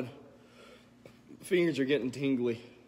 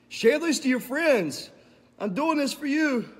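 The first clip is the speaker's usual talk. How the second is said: excited; 11 semitones higher, 6 dB louder than usual. Real, not acted. Hyping the viewers before challenge.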